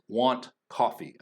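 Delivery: slow, separate beats on "want" and "coffee"; beats on content words. Shown as a demonstration. Teacher naming the content words, stressed beats. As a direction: lean on "want", then "coffee". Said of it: "beat is always falling on those content words".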